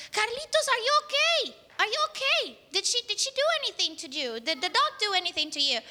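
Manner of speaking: high-pitched anxious questioning; acted worried mom. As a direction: play a fretting mom, high then tumbling into quick questions. Mom checks on son, worried.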